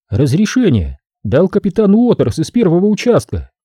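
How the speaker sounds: a businesslike woman explaining the job; woman worker. Acted. Sophie voiced.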